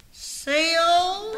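loud, drawn-out rising call. Acted. Woman calling her friend Seal a second time, calling out. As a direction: holler the name upward, impatiently. Calls Seal again, louder.

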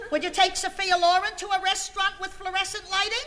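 loud, high acted protest; indignant outburst. Wife confronting husband over the restaurant, loudly indignant. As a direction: throw the question out at the top of the voice.

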